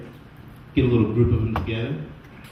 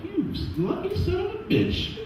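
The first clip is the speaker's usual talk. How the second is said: much higher, laughing mock praise; mock congratulations; 6 dB quieter than usual. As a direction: gush with fake envy. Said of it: "And I'm like".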